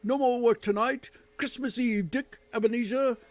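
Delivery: loud, high, booming merry announcement; jolly shout. Acted. Fezziwig telling his apprentices work is done, booming and merry. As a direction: call the whole shop to celebrate.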